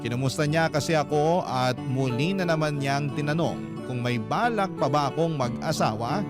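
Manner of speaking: ordinary talk, nothing marked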